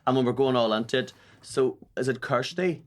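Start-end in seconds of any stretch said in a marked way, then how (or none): none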